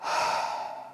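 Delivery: a long, audible, exaggerated out-breath; exaggerated breath sigh. Shown as a demonstration. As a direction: let the breath out with a big sigh to show its sound. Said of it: "I will exaggerate".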